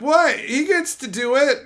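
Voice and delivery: mock-outraged coworker; outraged disbelief. Coworkers protesting his special treatment, high indignant disbelief.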